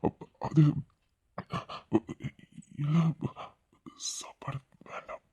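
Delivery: slow, breathy intimate refusal; hushed whisper. Acted. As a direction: turn the food down in a low whisper. Husband's hushed intimate reply. (மெதுவான கிசுகிசுப்பு)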